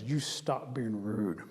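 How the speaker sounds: low, slow warning; stern Bear. Acted. Bear grabs Rabbit by the ears, stern warning. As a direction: drop low and draw out the threat.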